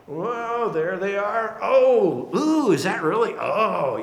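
mock shock of onlookers keeping their distance; appalled Pharisees. Preacher playing separate ones spotting sinners, appalled. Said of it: "The Pharisees mean separate ones".

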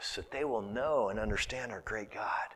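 preacher whispering to the congregation. Real, not acted. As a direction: hush it reverently. Soft sermon close of thought.

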